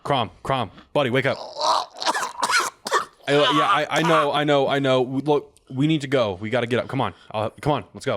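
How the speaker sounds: loud pleading urge; urgent wake-up. Acted. Player reviving his unconscious friend, loud urgent. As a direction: shake your friend awake, urgent.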